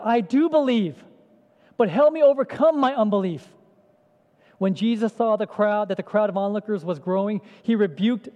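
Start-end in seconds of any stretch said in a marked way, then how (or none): 0.0-3.5 loud, higher desperate cry; cried out. Acted. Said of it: "The father instantly cried out".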